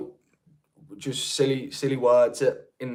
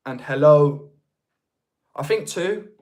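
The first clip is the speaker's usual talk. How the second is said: ordinary talk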